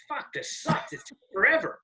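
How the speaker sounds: high, breathy fed-up whine; whiny groan. Retold or imitated. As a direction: groan it like a bored listener. Imagined bored interviewer groaning.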